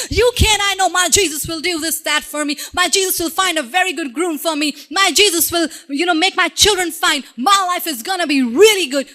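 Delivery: bubbling over with hope; excited believer. Retold or imitated. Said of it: "we become excited".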